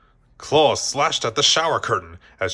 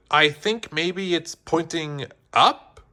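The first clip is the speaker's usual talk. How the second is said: Jessie line; 5 semitones higher, slower than usual. A narrator's reading. Jessie voiced in read story.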